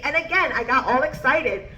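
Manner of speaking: ordinary talk, nothing marked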